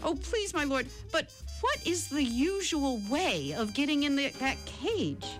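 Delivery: high-pitched, bewildered plea; begging. A narrator's reading. Jackal begging the tiger to explain, bewildered. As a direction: implore in a high, puzzled voice. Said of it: "he begged the tiger".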